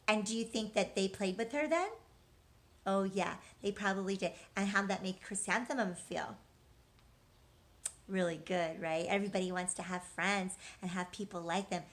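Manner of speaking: usual speaking voice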